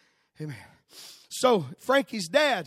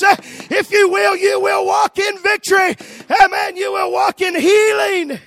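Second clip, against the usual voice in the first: full-force preaching; 7 semitones higher, 12 dB louder than usual. Real, not acted. Preacher promising the church victory and healing, full-force preaching. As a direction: build into a loud, high revival shout.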